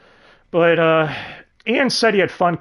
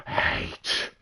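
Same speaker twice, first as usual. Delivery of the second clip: voiceless, softer villain echo; sinister whisper; 8 dB quieter than usual. Acted. Claude dwells on the soul he wants, sinister whisper. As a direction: whisper it like a plotting demon.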